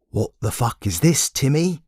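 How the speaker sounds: plain reading voice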